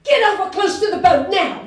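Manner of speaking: loud, high urgent Australian command; Australian yell. Retold or imitated. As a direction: order her to the boat, fast and loud. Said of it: "in his Australian accent".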